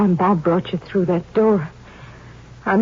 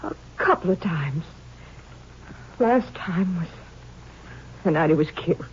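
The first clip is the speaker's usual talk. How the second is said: softer and lower, close to tears; tearful; 5 semitones lower, 7 dB quieter than usual. Acted. Widow telling the ranger about her husband, tearful. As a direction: voice catching as she remembers him.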